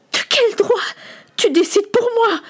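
very high, outraged challenge; fuming, tearful. Acted. Fuming son challenges his father's right, tearful outrage. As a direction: demand it in a high, furious voice. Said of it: "Samuel fulminait. Les larmes coulaient".

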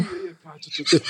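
gagging, on the edge of vomiting; dry heaving between words. Real, not acted. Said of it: "eu vou vomitar".

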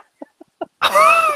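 a loud, very high whoop of laughter; big high laugh. Real, not acted. Owner laughing as the landlord lends him ten grand. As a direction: burst out laughing in delight.

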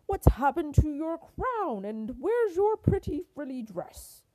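loud, indignant royal scolding; cross king raising his voice; thundering king. A narrator's reading. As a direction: build into a high, loud telling-off. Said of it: "he thundered".

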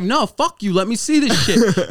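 defiant yell. Retold or imitated. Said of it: "I'm like".

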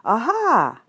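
loud, high Aha! in a different voice. Acted. Group signal that never happened, loud. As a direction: bellow the Aha. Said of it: "no loud group spoken".

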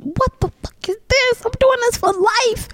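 very high, squeaky elated voice; amazed squeal. Retold or imitated. Comic reliving a first standing ovation, amazed squeal.

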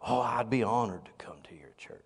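breathless hushed whisper of amazement; awed whisper. Retold or imitated. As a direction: whisper it like he's starstruck. Guest whispers acceptance.

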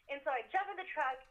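usual speaking voice

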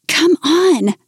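eager. Acted. Eager call to friend.

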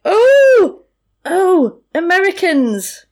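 a thrilled pub regular spotting visitors; excited local. Retold or imitated. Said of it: "a guy's like".

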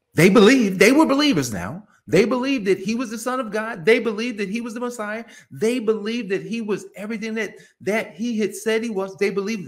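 high, insistent preaching that starts loud; emphatic preaching. Real, not acted. Preacher insisting Mary and Martha were believers, high and emphatic.

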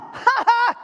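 high gloating "ha ha"; bratty toddler laugh; mimicked kid laugh. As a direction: copy the child's taunt.

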